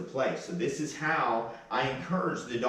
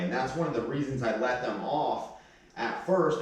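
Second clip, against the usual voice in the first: ordinary delivery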